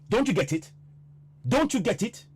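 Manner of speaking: high, exasperated demand; real exasperation at the listeners; really worked up. Real, not acted. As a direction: plead in frustration, pitch rising on the repeat. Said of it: "I'm getting really worked up".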